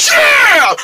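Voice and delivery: very high, shouted yeah; battle cheer. Acted. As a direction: whoop to rally the gang. Gang cheers on the plan.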